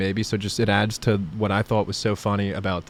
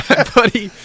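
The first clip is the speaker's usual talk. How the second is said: laughing; 12 semitones higher, 5 dB louder than usual. Real, not acted. Laughing while telling prank story.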